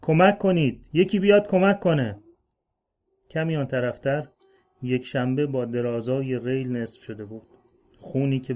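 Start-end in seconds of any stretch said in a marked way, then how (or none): 0.0-2.2 loud, higher desperate cry; shouting for help. Acted. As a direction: call out for help in panic. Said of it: "داد کشید".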